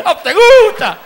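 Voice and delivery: high, loud mock rebuke; deacon rebuking demon. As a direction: taunt the devil with the Bible. (diácono increpando al demonio a gritos)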